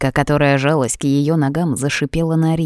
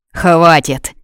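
growled; 5 dB louder than usual. A narrator's reading. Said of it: "прорычал Рик".

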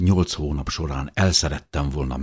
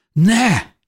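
high, loud cry of protest; desperate shout; 7 dB louder than usual. A narrator's reading. Narrator cries out as beloved gives up, desperate. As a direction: cry out in despair, voice leaping up. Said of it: "kiáltottam kétségbe esetten".

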